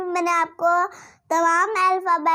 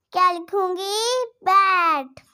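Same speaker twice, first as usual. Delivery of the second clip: ordinary talk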